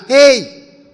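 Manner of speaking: loud high call of "Ei" from across the queue; retold shout. Stranger in the check-in queue hails the speaker: loud call. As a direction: hail him loudly from three rows back. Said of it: "gritou de lá".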